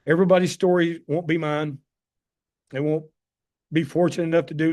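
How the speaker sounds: normal talking voice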